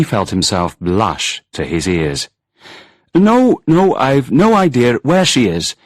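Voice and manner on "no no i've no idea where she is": higher and louder, flustered denial; flustered protest. A narrator's reading. Angus denies knowing where Susie is, flustered loud protest. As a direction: blurt it out, caught and embarrassed. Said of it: "he protested".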